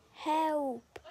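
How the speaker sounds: drawn-out little cry for help; lightly voiced tiny plea; tiny voice calling. A narrator's reading. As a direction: call out as a tiny trapped creature. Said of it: "Rachel heard a tiny voice".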